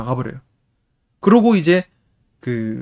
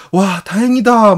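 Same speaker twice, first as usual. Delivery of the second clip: 높고 크게, 길게 늘인 안도; 용서받아 기뻐하며 외치기; 안도하는 아이 흉내; 7 semitones higher, 7 dB louder, slower than usual. Retold or imitated. 용서받은 아이의 안도. (신난 꼬마 말투)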